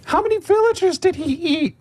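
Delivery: put-on very high child's voice; an eager kid asking bluntly; little girl voice.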